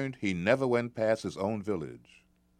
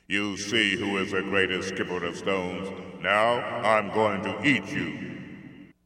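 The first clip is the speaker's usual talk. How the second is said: slow, loud giant threat; menacing giant; 5 dB louder than usual. Acted. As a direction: savor the threat like a hungry giant. Giant threatens to eat boy.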